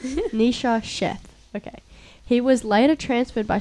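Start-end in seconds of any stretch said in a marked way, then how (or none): 0.0-1.2 slow, careful name reading; careful tongue twister. Real, not acted. Reader retries a hard victim's name, slow and careful. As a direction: sound out the tricky name slowly. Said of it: "Well, tongue twister".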